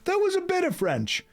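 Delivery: high mock protest.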